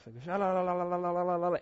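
imitating rapid babbled tongues in a put-on voice; mock speaking in tongues. Preacher mimicking a crowd of tongues-speakers, mock babble. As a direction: rattle off nonsense syllables like a tongues-speaker. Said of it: "everyone is just busting out tongues".

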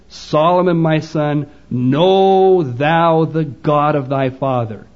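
slow, weighty King James reading; stately slowed scripture quote; solemn slow charge. Retold or imitated. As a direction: draw each word out like a father's charge. David's charge quoted again.

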